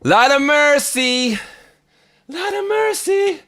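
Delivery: high, slow, drawn-out awe; amazed exclamation. Real, not acted. Amazed by his own beat.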